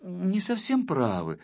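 high-pitched, hesitant pupil voice; timid. A narrator's reading. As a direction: venture a tentative, placating opinion to teacher. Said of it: "несмело сказал". (несмело, робко)

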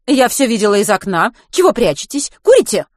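put-on strict vice-principal catching boys outside; stern teacher. A narrator's reading. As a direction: interrogate like a strict head teacher.

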